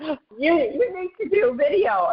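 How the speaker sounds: the leader urging her to film, mock insistent voice; imitating Nadia, no accent. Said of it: "not gonna even pretend to speak in the Russian accent".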